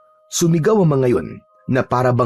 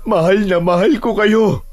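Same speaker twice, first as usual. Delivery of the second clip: higher, tearful voice with broken pauses; tearful grief; 7 semitones higher than usual. Acted. Amaro weeping for his dead wife and child, tearful grief. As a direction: call out to lost loved ones through tears. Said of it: "hindi niya napigilan ang mapaiyak".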